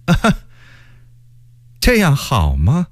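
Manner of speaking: high, coy little query; feigned questioning. A narrator's reading. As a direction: ask it knowingly, pretending doubt. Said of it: "又故意问道".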